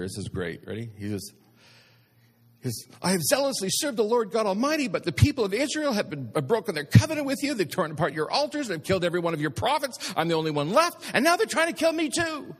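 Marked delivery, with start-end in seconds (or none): 3.0-12.5 high-pitched, overwrought prophet's complaint; hammed-up panicked outburst, pitch soaring; frantic Elijah. Elijah answers God in a panic, frantic and high-pitched. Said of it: "Trying to go spectacular".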